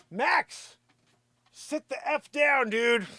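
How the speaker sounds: high-pitched exasperated command; high, fed-up telling-off; dog scolding. Real, not acted. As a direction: order a misbehaving dog down. Orders the dog to sit.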